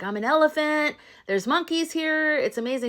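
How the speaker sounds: high, wondrous introduction; excited elephant. Retold or imitated. Horton describing his world to the scientist, excited. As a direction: share the marvel with a new friend. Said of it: "he's so excited".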